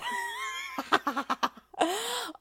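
high, long burst of laughter; cackle. Acted. After telling a pun, high delighted cackle. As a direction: crack up at your own joke.

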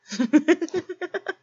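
high, loud derisive laugh; loud mocking laugh. Mocking the begging listener with a high loud laugh.

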